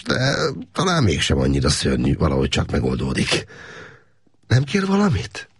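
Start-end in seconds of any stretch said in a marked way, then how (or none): 4.5-5.5 higher, lightly voiced woman asking; a caring woman offering help; a hint of a higher female voice; woman's voice. A narrator's reading. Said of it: "kérdezte a nő".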